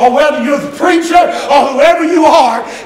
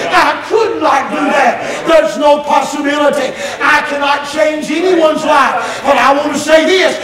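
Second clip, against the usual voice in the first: usual speaking voice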